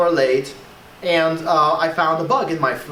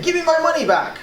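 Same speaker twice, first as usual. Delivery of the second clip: pitched up, a mock rude demand; 9 semitones higher than usual. Shown as a demonstration. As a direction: bark it like a pushy customer. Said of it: "It's too rude".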